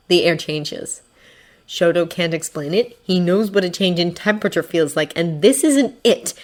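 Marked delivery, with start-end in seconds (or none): none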